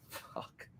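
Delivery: barely audible, under-the-breath reaction; tiny voiceless aside; whispered curse. Retold or imitated. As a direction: whisper it to yourself, stunned. Stunned private reaction to caller.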